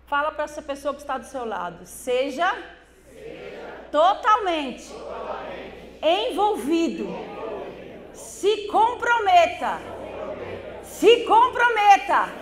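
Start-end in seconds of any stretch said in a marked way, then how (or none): 1.8-12.3 building to a full preaching shout, slow and louder. Real, not acted. Pastor crying out to the congregation to commit, preaching shout. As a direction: urge them to commit, climbing to a cry. Congregation told to repeat exhortation.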